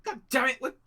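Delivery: high, exasperated yell; loud, urgent cursing and commanding; shouting out the window. Acted. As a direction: shout frantic orders from a window. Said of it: "shouting out the window".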